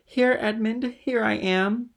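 high, loud, joyful call; elated greeting cry; eager call. A narrator's reading. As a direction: call out eagerly to a sweetheart, drawing out the end. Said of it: "rushing towards the door".

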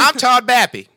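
high, loud put-on character voice; Todd Bappy voice. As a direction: belt it out in a silly high voice. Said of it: "as Todd Ba".

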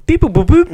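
high, loud robot beeping; droid beeps. Pretend. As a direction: beep like a little droid. Droid noise in bong skit.

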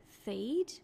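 "feed" with the tongue between the teeth; interdental lisp. Shown as a demonstration. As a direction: lisp the s of seed so it comes out "feed". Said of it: "When I make an interdental lisp ... it sounds like this".

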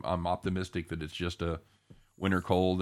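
normal talking voice

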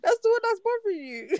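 high-pitched, laughing squeal; laughing disbelief. Real, not acted. Co-host teasing friend over what bothers them, laughing disbelief. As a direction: squeal it through real laughter.

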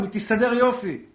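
loud and high, fervent sermon; preaching at full force. Acted. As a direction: rouse the crowd with a fiery story.

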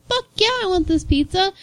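eager yes. Retold or imitated. Coworker eagerly accepts pizza.

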